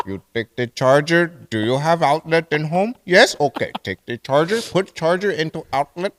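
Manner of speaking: put-on Indian-accented agent giving step-by-step instructions; mock Indian accent. Agent guiding caller to charge phone, mock Indian accent. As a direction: talk down as Indian tech support. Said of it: "I can do the Indian voice".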